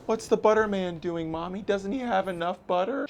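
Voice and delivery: 小孩儿的声音，音调很高; high little kid asking mommy; child voice. Acted.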